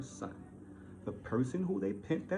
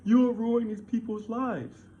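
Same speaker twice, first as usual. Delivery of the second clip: high, slow mocking imitation; mocking whiny quote; 6 semitones higher, 6 dB louder, slower than usual. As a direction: parrot his reply with contempt. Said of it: "What man responds back to a man".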